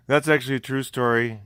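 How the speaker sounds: usual speaking voice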